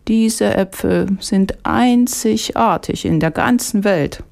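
high, slow market woman; old market woman's slow, high sales pitch; vegetable seller. A narrator's reading. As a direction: draw it out high, praising precious wares. Market woman praising her apples.

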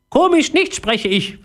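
very high echo of the parrot; pondering parrot echo. A narrator's reading. Philipp puzzles over parrot's words, echoing its high voice. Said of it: "wiederholte Philipp langsam und überlegte".

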